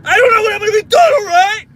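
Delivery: loud, high, hammed-up screaming; exaggerated angry yell. Parent blowing up at son, mock screaming. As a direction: scream it fast, over the top. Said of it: "I never talk like that".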